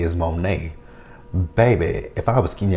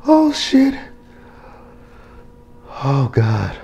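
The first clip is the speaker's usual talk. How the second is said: squeaky high shocked gasp of a curse; real shock jumping way up in pitch; high startled exclamation; 8 semitones higher, 4 dB louder, slower than usual. Real, not acted. Shocked reaction while recapping.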